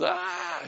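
high falsetto mock shriek; mock shriek of terror. Preacher imitating sailors shrieking at a ghost, high mock shriek. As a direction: squeal like a terrified sailor. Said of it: "I don't know what it sounded like, but it was".

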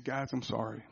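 low, very soft apology; subdued imitation of a grieving man, no sobs; retelling a crying man. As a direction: quote his apology gently and low. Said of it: "and he starts crying".

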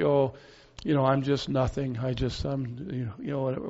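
mock-humble, fading to a soft mumble; false humility. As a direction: imitate false modesty, voice sinking away quietly. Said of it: "we have this false humility".

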